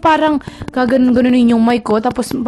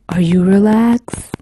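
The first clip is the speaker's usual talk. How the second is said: lower, slow, intimate ASMR murmur; 4 semitones lower, slower than usual. Pretend. Vlogger demonstrating ASMR to viewers, lower slow intimate voice. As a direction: lull the listener to close their eyes. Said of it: "That relaxing?".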